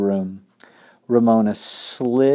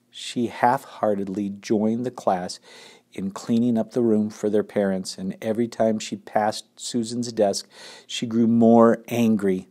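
ordinary delivery

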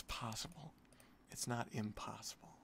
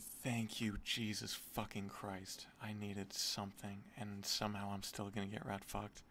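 ordinary talk, nothing marked

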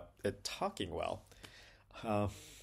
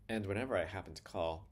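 usual speaking voice

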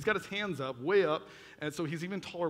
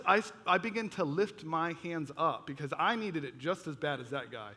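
ordinary delivery

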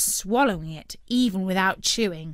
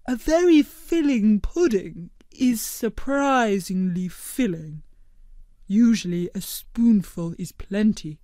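gentle, measured cartoon creature; Blobbert voice; 5 dB louder than usual. A narrator's reading.

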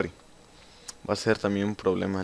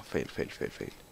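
breathy whispered fail, fading out; 10 semitones lower, 10 dB quieter, slower than usual. Real, not acted. Susurro al fallar el parkour. (susurrando fail, apagándose)

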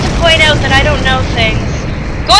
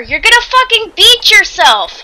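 ordinary talk, nothing marked

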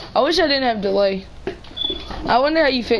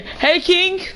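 high-pitched playful greeting; excited hail; 8 semitones higher than usual. Pretend. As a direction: hail an old acquaintance, pitched way up. Hails player King in game.

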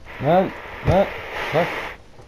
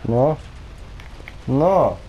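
shouted no; 6 semitones higher, much slower than usual. Real, not acted. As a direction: yell the no in protest. Rising repeated no.

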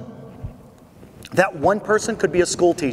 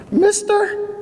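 much higher, thin tearful call; frail old lady; 14 semitones higher, 3 dB louder than usual. Retold or imitated. Old lady in the nursing home calling to him, tearful high. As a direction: voice the paralyzed old lady, tremulous. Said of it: "and she goes".

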